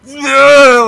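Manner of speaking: loud sharp groan of mental agony; pained groan. Acted. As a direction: let out a sudden anguished groan. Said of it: "It's just going".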